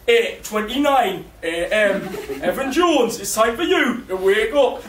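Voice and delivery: drawn-out wake-up announcement, lightly put on; alarm clock wake-up call. Comic imagining his alarm waking his housemate, wake-up announcement. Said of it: "alarm clock wake-up call".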